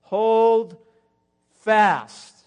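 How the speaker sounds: very loud, drawn-out proclamation; booming command. Real, not acted. Preacher charging the congregation, loud and drawn out. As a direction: boom each word as a charge. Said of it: "That's a word for somebody".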